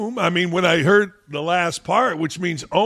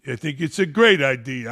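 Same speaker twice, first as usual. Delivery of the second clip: usual speaking voice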